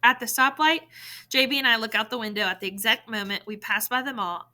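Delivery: ordinary delivery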